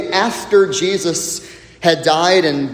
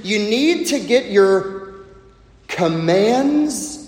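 usual speaking voice

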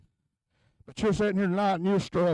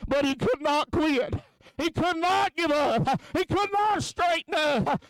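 higher, driving preacher's cadence; full-force preaching; 8 semitones higher, slower than usual. Acted. Preacher warning the congregation about addiction, driving preaching. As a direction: push the warning home to the church.

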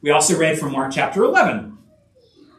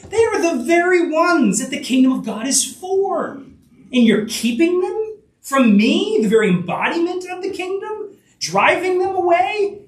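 indignant; 10 semitones higher than usual. Retold or imitated. Voicing Jesus's indignation.

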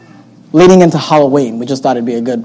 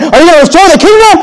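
mock disciples; 9 semitones higher, 3 dB louder, faster than usual. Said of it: "Here they said".

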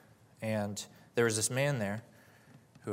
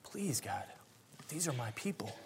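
soft, hushed plea to God; pleading; 8 dB quieter than usual. Retold or imitated. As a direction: quietly beg for mercy on behalf of others. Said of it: "He pleaded with God".

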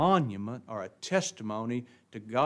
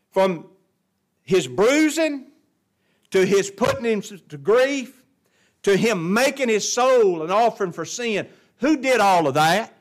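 louder, higher, hammering each phrase; forceful preaching; 8 semitones higher, 9 dB louder than usual. Real, not acted. Preacher pressing the congregation on who did this, forceful preaching. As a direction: preach it out in raised, punched phrases.

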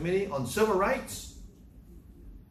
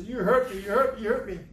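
imitating crying girl; 6 semitones higher, 4 dB louder than usual. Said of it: "she was crying".

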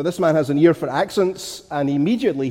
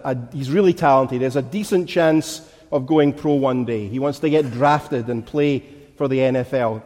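usual speaking voice

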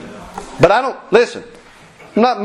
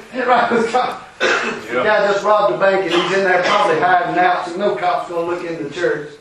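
ordinary delivery

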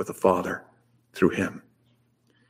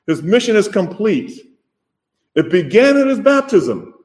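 higher, louder, building preacher's cry; rising preaching; 9 semitones higher, 4 dB louder than usual. Acted. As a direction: lift the congregation with each word.

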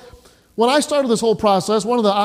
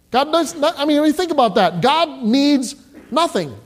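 higher, emphatic preaching; 7 semitones higher than usual. Real, not acted. Preacher emphasizing God's sufficiency.

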